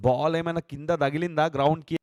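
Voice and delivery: ordinary delivery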